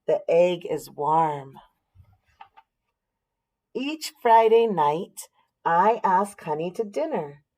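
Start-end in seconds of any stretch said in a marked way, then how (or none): none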